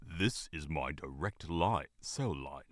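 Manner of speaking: low, slow, growled warning; growling. A narrator's reading. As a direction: growl it like a buffalo. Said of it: "then growled".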